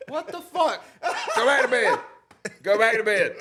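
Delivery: high, loud mock wife yelling. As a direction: play the annoyed wife shouting him back to bed. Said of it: "My wife going".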